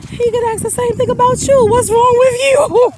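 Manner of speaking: can't get the friend's comeback out without cracking up; quoting her friend's comeback through laughter; laughing quote. Retold or imitated.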